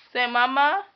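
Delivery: high, loud sing-song coaxing. Real, not acted. Mother coaxing her baby daughter, high sing-song baby talk.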